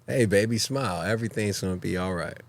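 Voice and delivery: lightly voiced flirt telling her to smile; smooth charmer. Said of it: "[name], it's your turn. Hit it".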